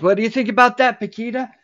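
lightly imitated pleased-with-himself question; football-obsessed Roger. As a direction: play a self-absorbed talker seeking approval. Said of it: "Roger's like".

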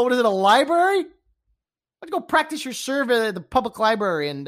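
ordinary delivery

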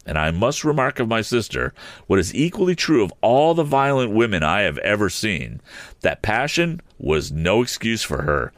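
plain reading voice

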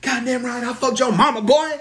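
high, hammed-up gloating yell. Devon taunting the heckler from the ropes, mock gloating. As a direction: crow it up at the heckler, hammy. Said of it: "And he's like".

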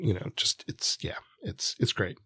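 drops to a near whisper; whispered trail-off. Real, not acted. Cohost trailing off quietly while praising the story. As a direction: let the words fade out unvoiced.

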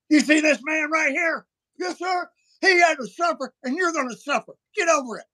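harsh high shouting priest scolding a penitent; rough priest's rebuke. Retold or imitated. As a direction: bark it like a tough confessor with no sympathy. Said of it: "he was rough with me in counseling".

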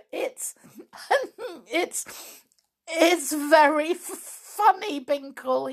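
high laughs breaking the words; gurgling laughter. Acted. Flip laughing at Binkle, gurgling with laughter. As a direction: laugh so hard you can't speak. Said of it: "between his gurgles of laughter".

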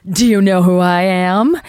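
mock self-important star; diva demand. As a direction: play the vain celebrity. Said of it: "be like".

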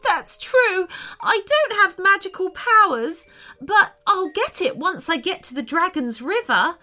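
high, tearful unicorn voice; high-pitched acted tearfulness. A narrator's reading. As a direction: play a hurt little unicorn, pitched high. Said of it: "made Sophia burst into tears".

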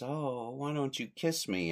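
sleepy lady. Retold or imitated. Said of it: "talking in her sleep".

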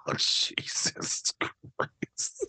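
slow, whispered disbelief; whispered, drawn-out exasperation; exasperated whisper. Real, not acted. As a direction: draw it out under your breath. Exasperated reaction to co-host's teasing.

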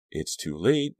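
ordinary delivery, nothing marked

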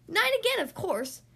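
high, loud blurted answer; show-off little duck's shriek, toned down; mock scream. A narrator's reading. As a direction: scream it out, then ease off. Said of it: "Thistle screamed".

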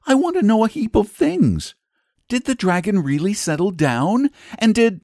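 put-on high, eager questioning child; acted little girl's urgent curiosity; Charlotte. A narrator's reading. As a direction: press for answers before he leaves. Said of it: "cried Charlotte".

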